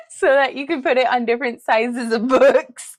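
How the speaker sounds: giggling through words; words breaking with laughter; laughing while talking. Real, not acted.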